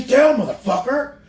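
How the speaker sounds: high, sharp mock shout of an angry wrestler; imitated angry yell. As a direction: yell it at him like a furious partner. Retelling X-Pac barking at him.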